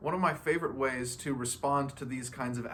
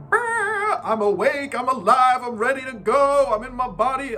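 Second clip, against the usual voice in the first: much higher, loud brr then cheering; shivering burst of energy; 9 semitones higher, 10 dB louder than usual. Retold or imitated. After a cold shower, a loud excited shiver. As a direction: shout like you just stepped out of icy water.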